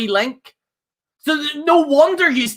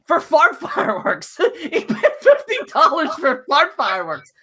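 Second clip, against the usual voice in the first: laughing; 4 semitones higher than usual. Real, not acted. Laughing repeat.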